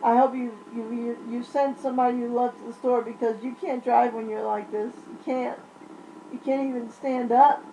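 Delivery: ordinary talk, nothing marked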